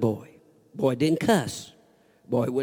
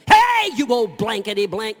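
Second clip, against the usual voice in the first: mocking yell; 6 semitones higher, 6 dB louder than usual. Boys cursing the old man.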